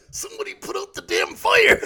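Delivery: high, loud, drawn-out wrestler shout; Macho Man. Acted. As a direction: bellow it like a hammed-up wrestler. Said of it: "If he was talking to Macho Man".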